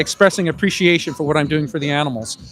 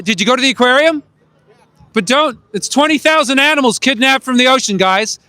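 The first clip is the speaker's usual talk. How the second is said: raised, higher voice to passers-by; calling out; 6 semitones higher than usual. Real, not acted. Activist hailing people about the aquarium, calling out. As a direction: call out urgently to strangers.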